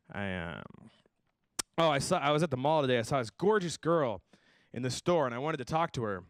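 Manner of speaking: ordinary talk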